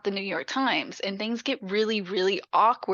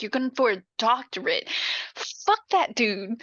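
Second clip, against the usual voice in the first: emphatic pep talk. Retold or imitated. Mom dismissing the rival son, emphatic pep talk. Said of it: "like, this pep talk".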